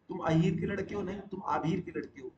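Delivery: ordinary talk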